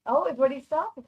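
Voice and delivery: ordinary talk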